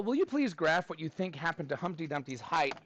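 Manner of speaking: ordinary talk, nothing marked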